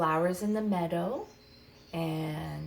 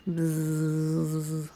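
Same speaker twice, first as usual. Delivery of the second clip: bee buzz; 5 dB louder than usual. Acted. Teacher buzzing during the bee matching game.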